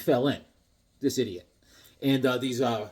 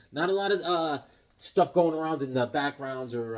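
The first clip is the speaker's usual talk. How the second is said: ordinary talk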